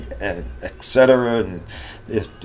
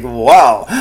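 exasperated wow; 9 dB louder than usual. Real, not acted. Exasperated at endless election talk.

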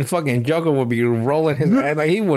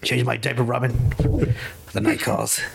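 Batman; 4 semitones lower, 3 dB quieter than usual. Acted. Baby superhero orders his sidekick about, mock-solemn and low. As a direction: play the grim crime-fighter, softly and low. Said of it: "He would just be tied to Robin".

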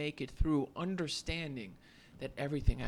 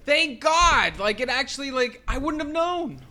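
much higher, louder exclaim; relieved thanks; 11 semitones higher, 13 dB louder than usual. Retold or imitated. Friend recalling his relieved cry, high and loud. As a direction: burst out with grateful relief. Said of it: "I remember you saying to me".

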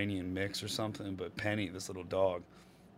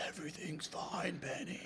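soft soothing; 6 semitones higher, 14 dB quieter than usual. Retold or imitated. Soothing the dog.

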